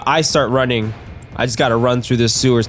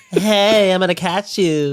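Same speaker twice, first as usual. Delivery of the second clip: high playful taunt; goofy unicorn voice; 7 semitones higher, slower than usual. Pretend. Player voicing the unicorn chasing him, high and playful. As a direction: tease in a high silly voice. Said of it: "Be like".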